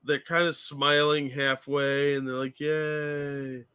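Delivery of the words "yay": soft, half-hearted "yay"; unenthusiastic cheer. Retold or imitated. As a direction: cheer like you barely mean it. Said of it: "they don't look enthusiastic".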